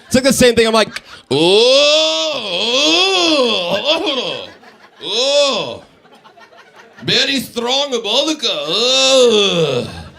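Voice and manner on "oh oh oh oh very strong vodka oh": hammed-up old Japanese woman reacting to liquor; long wailing ohs, slow mock Japanese accent. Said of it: "I turn into, like, an old Japanese woman".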